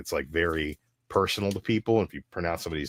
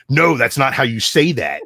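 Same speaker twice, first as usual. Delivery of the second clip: retold objection; 4 semitones higher, 7 dB louder than usual. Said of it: "I just would be like".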